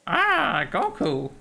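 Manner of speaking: put-on high old hermit voice; squeaky old master croak; Master Roshi impression. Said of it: "be your Master Yoshi".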